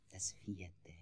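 breathless hissing whisper; snake's whisper. A narrator's reading. The snake whispers its secret to the old man. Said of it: "sagte die Schlange".

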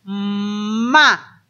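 "ma" with a long hummed m sliding into a; phonics-style stretched "mmma"; joining two sounds. Shown as a demonstration. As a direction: hold the m of "ma", then open to the vowel. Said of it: "si juntamos los dos sonidos".

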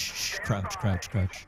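quiet urgent hush; hushed whisper. Pretend. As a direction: keep it low, sneaking. Sneaking up on enemies.

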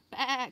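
mock sheep bleat; lamb bleat. A narrator's reading. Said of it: "cried the lamb".

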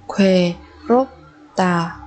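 "Khuê rốp tà" in separate syllables. Shown as a demonstration. Teacher modelling a new word for learners, syllable by syllable. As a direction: break "Khuê, rốp, tà" apart with pauses.

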